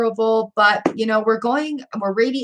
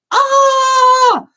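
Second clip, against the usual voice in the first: sudden high, loud squeal of delight; joyful high shriek; 7 dB louder than usual. Real, not acted. Reader to a viewer named Charlie Bear, joyful high shriek. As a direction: let out a shriek of pure joy. Overjoyed at viewer's coming greatness.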